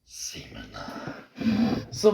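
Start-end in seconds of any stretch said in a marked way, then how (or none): 0.0-0.7 whisper. Acted. Naming the forest, punchline.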